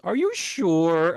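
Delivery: high, slow, loud doubting question; mock doubtful clerk. Store clerk cocks her head at the customer, exaggerated doubt.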